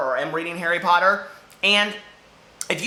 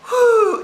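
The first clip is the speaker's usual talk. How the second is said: whew; 7 dB louder than usual. Real, not acted. Overheated, blowing out breath.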